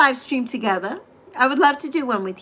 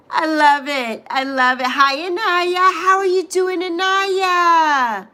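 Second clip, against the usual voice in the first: ordinary delivery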